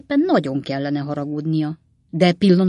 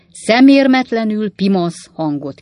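loud, raised indignant echo; affronted, emphatic narrated retort; outraged retort; 5 semitones higher, 9 dB louder than usual. A narrator's reading. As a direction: throw the words back in outrage. Pickwick throws the insult back.

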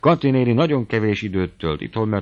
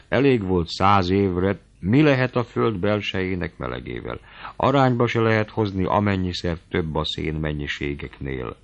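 ordinary delivery, nothing marked

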